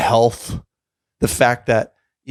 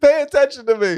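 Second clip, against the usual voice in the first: whiny attention-seeker voice. Retold or imitated. Voicing attention-seeking North Korea.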